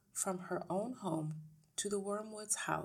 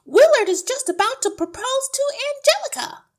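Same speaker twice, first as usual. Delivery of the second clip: very high, loud wail of protest; crying out; 20 dB louder than usual. A narrator's reading. Wife missing the proposal on TV, crying out. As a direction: protest at the top of a high put-on voice. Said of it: "Missus Wormwood cried out".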